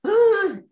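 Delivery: sudden high loud cry of grief, acted out; loud wail. Showing how others cry over lost children, high loud wail. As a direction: let out one sharp wailing "Ah!". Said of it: "I did not cry like".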